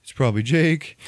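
high mock panic; dad's panic, imitated. Dad recalling fear about his missing son, mock high panic. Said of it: "I was like".

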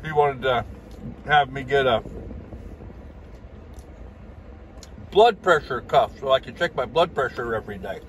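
speaking between bites of salad; talking with food in mouth; chewing while talking. Real, not acted. Seen 1: Forks salad to mouth near +0.7, jaw working between phrases.

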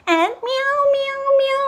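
high mock meowing; cat meow. Shown as a demonstration. Teacher meowing for the toddler, high. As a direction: meow like a kitty for a child. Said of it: "imitating a cat".

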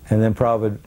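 normal talking voice

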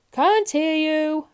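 loud, high, slow roar; booming drawn-out shout; roaring milkman. A narrator's reading. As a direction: roar it, dragging the words out. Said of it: "roared the milkman".

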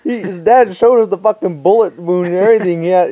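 ordinary delivery, nothing marked

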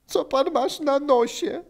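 high-pitched mock hilarity; rozbawiony Benek. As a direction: ask it high, about to crack up. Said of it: "formalnie zaryczał ze śmiechu". (wysokie pytanie, bliski śmiechu)

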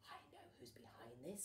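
hero whisper. Acted. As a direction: whisper the realisation darkly. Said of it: "said Sue Potato".

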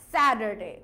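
American স্যাটারডে as "sader-day", low and loud; American D sound for the T, R sounded; American accent. Shown as a demonstration. Teacher recapping T said as D, American accent. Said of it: "American অ্যাকসেন্টে কি হয়ে যাবে?".

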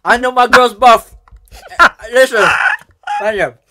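loud, high, excited ranting; hyped shouting rant. Pretend. Hyped rant about girlfriend.

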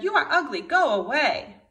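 snooty herons shooing him off; mean herons. Acted. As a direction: jeer it out as spiteful herons. Mean herons voiced in story.

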